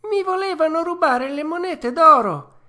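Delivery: high, loud puppet voice; wronged and loud; indignant puppet. A narrator's reading. Pinocchio telling the fox and cat of the robbery.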